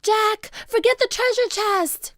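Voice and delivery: high, loud pleading call; urgent cry. A narrator's reading. Annie calling Jack off the chest, crying out. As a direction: cry out to pull them away. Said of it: "cried Annie".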